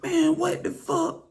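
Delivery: high, slow, stretched disbelief; a very high drawn-out what the fuck; shocked. Retold or imitated. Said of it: "So I was like".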